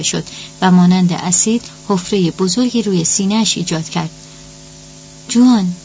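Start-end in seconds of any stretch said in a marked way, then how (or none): 5.3-5.7 louder, higher narrated cry; sudden raised-voice exclamation, short of a full scream. A narrator's reading. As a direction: call the name out in alarm. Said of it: "بانی فریاد".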